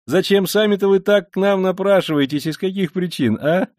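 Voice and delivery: sly, merry investigator, high and bantering; sly cheerful Porfiry. Acted. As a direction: tease him like a fussing child. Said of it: "весёлым, лукавым".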